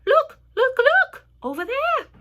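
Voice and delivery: very high, loud, repeated calls; squealed shouts of discovery; excited shouting. A narrator's reading. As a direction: urgently point everyone to the sight. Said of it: "shouted the ladybug".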